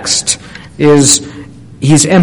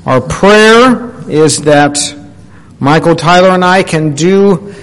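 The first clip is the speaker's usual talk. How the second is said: ordinary talk, nothing marked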